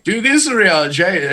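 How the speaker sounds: high, slow bossy order; Y'shtola. Acted. Imitating her ordering him about, high and commanding. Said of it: "And Y'shtola's like".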